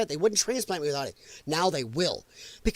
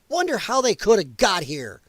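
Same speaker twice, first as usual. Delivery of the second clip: ordinary talk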